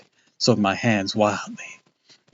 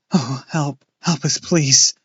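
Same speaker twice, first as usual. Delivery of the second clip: pleading cry; 5 semitones higher, 6 dB louder, slower than usual. A narrator's reading. Crying for help.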